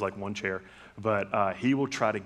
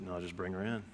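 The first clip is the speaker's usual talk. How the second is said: normal talking voice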